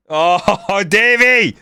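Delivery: high, drawn-out wail of dismay; exasperated outcry. Real, not acted. Gamer blaming Davey for bringing this on, high exasperated cry.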